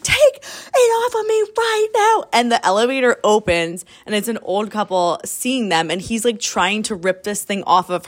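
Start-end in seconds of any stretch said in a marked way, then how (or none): 0.0-2.2 much higher, crying-edged plea; desperate plea. Retold or imitated. Retelling the bride begging to get the corset off, high panicked cry. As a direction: beg in a high, cracking panic.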